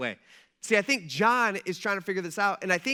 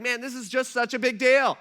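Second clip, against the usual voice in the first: excited exclamation; 5 semitones higher, 6 dB louder than usual. Retold or imitated. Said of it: "he's just like".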